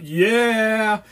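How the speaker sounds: high, loud cheer; retold crew shout. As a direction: imitate a crowd cheering back. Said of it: "the crew would shout".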